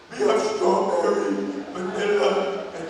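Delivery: slow, mock-laryngitis menu listing; imitated hoarse drive-thru worker; hoarse intercom voice. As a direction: labour through each flavour in a put-on hoarse voice. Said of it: "After hearing her voice".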